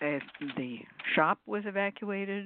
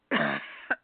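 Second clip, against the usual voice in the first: real low hacking cough; 13 semitones lower than usual. Real, not acted. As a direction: clear a cough mid-talk. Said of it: "I came back with a cough".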